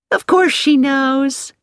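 high, drawn-out cheery assurance; merrily matter-of-course, pitched well up; cheerful. A narrator's reading. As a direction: sing out the reassurance brightly and slowly. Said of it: "said Missus Baker cheerfully".

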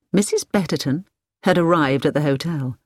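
usual speaking voice

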